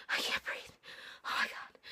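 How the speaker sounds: a gasping, hushed whisper; a starstruck, breathless whisper. Retold or imitated. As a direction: whisper like she can't get air. Sister overwhelmed by excitement.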